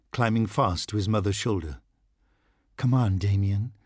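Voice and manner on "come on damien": ordinary delivery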